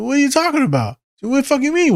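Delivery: high, exaggerated puzzled friend; imitating a baffled friend; mock confusion. Friend playing dumb, mock confusion.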